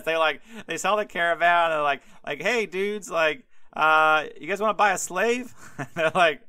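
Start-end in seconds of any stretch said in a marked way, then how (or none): 2.4-5.5 high, breezy dude pitching a sale; casual dude voice. Acted. Brothers hawking their brother to traders, breezy dude voice. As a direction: sell it like a bored teen hustler.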